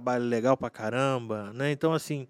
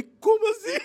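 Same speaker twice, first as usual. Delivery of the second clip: very high, loud, laughing disbelief; retold astonishment; 6 dB louder than usual. Maestro reacting to the sudden resignation, laughing mock astonishment. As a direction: squeal it through laughter, mock stunned.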